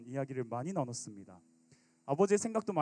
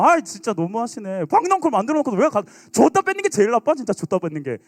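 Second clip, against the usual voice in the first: loud, high petulant grumble; sulking Jonah, loud and high; Jonah's complaint; 4 semitones higher, 12 dB louder than usual. Acted. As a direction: whine like a cheated child. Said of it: "요나가 이 표현한 거라니까요".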